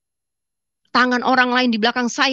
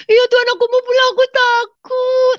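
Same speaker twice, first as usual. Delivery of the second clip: put-on much higher and louder, childlike and scared; frightened little child; 5 dB louder than usual. The dreamer begging God to go home, childlike and afraid. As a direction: plead like a scared small kid. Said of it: "kayak anak kecil di hadapan Tuhan".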